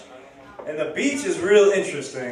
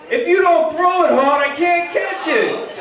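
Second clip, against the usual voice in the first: high, loud yell with a drawn-out end; 12 semitones higher, 3 dB louder than usual. Retold or imitated. Same beach guy shouting to his playmate, yelling out. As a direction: holler the complaint across the sand. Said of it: "he yells out".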